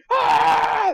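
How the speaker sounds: loud high whoop of an excited crowd; crowd cheer. Retold or imitated. Crowd in the theater cheering Optimus, a loud high yell. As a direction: yell like fans when the hero appears. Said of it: "people are cheering and screaming".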